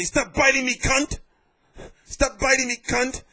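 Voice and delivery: high, loud mock yell of a man being bitten; imitating a fighter screaming to be let go; retold scream. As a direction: yell it like you're pinned on the floor. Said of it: "Then screaming".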